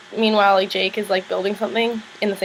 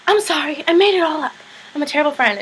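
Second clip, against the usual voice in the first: mock apology; 6 semitones higher, 3 dB louder than usual. [name] confessing the lie.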